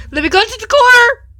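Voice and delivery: high, loud sing-song mock sob; mock crying. Reader fake-sobbing to viewers, high sing-song cry. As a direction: whimper it like fake weeping.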